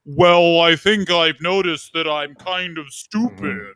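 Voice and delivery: put-on slow, dopey North American accent; mock North American accent. Mocking how Brits do a North American accent, slow and dopey. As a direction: drag it out like a Brit faking a North American accent. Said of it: "this is every British person's North American accent".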